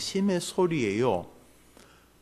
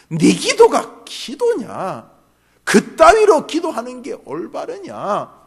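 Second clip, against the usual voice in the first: slow, louder, higher rebuke; loud accusation; 6 semitones higher, 9 dB louder than usual. Retold or imitated. Accuser mocking the prayer, slow and loud. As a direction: hammer the scorn at the praying self. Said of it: "소리는 뚜렷이 들리기 시작합니다".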